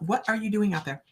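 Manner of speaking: normal talking voice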